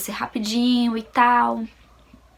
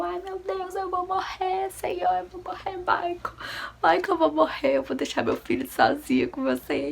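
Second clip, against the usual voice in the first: high, strained wail with thin whispery patches; panicked wail; 10 semitones higher than usual. Retold or imitated. Labouring wife wailing to husband that she will die, panicked. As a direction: plead in a high, drawn voice, acting out panic.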